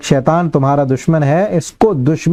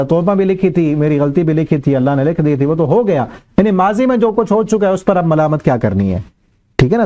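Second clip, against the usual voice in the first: ordinary delivery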